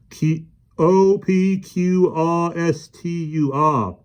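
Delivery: slow, high alphabet run; professor muttering letters. A narrator's reading. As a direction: count through the index.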